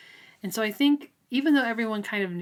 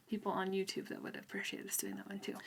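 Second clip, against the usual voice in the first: unvoiced whispered aside; 12 dB quieter than usual. Real, not acted. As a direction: drop to a breathy whisper, as if confiding. Aside while partner talks, unvoiced.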